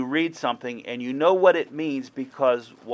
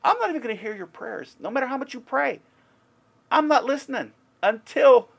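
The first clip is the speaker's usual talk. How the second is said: much higher and fast, building to a loud cry; God's angry refusal; 13 semitones higher, 7 dB louder than usual. Retold or imitated. Preacher voicing God refusing to hear prayers, loud and fast. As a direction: voice God cutting off the people's prayers, rising to a shout. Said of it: "he says".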